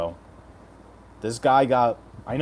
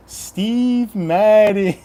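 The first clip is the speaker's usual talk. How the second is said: hype callout; 10 semitones higher, 9 dB louder, much slower than usual. Acted. Hyped name callout.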